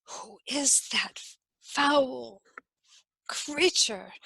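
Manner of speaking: hushed unvoiced whisper. A narrator's reading. Courtiers whispering in disbelief at the strange lady. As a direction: breathe it to your neighbour in shock. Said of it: "everyone stared in disbelief and whispered".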